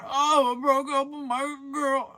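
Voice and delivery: high, loud mock whine; hammed-up whining, slow and high; whiny coworker. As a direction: mimic a coworker's sob story, high and drawn out. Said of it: "how you doing?".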